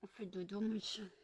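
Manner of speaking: very soft lulling to sleep; whispering over a crib. Real, not acted. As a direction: hush him to sleep. (chuchotement pour endormir)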